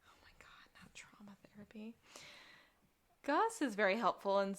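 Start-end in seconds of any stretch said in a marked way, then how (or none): 0.1-1.9 horrified whisper. Real, not acted. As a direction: hiss it under your breath. Appalled aside, fully whispered.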